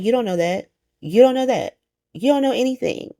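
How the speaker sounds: high-pitched, insistent mock rebuke; retold challenge. Recapper voices Anna doubting Pikeman's reassurance, high insistent challenge. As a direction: imitate someone scoffing at a useless answer.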